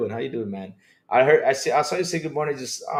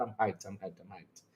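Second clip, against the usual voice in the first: low, soft repeats dying out; fading away; 7 semitones lower, 14 dB quieter than usual. Real, not acted. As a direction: let each repeat sink toward silence.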